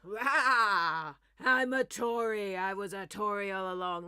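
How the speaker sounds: very loud, drawn-out roaring declaration; booming hammed-up confession; sudden mock reveal. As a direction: roar it out like a monster revealing its true self. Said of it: "he would suddenly go".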